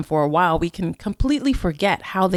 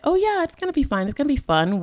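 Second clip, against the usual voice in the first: mock breezy self-assurance; 8 semitones higher, faster than usual. Voicing how we get seduced into thinking, mock breezy self-assurance. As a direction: brush off doubts with mock optimism.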